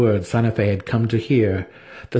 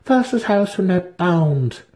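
higher, slow vow; solemn pledge; 10 semitones higher, slower than usual. A narrator's reading. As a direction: bind the house formally. Santa Fe pledges house.

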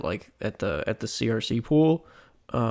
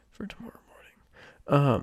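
whisper; 2 semitones higher, slower than usual. Real, not acted. Trailing off into a whisper.

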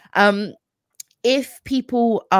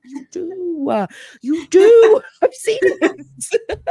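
squeaky laughing protest; laughing, high pitched; 13 semitones higher, 9 dB louder, much slower than usual. Retold or imitated. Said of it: "And I was like".

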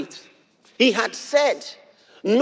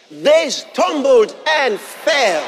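preached shout; 4 semitones higher, 4 dB louder, much slower than usual. Acted. Preacher proclaiming the enemies' fall.

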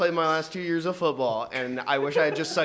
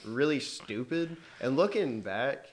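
usual speaking voice

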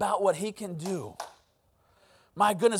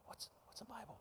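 hushed, hesitant whisper; barely audible acted whisper; whispered question; 3 semitones higher, 30 dB quieter than usual. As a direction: whisper it secretly to the kid beside you. Kid secretly asks friends in class.

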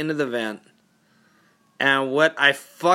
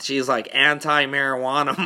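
ordinary delivery

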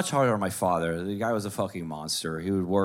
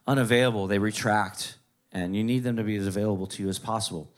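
usual speaking voice

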